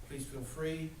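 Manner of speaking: ordinary talk, nothing marked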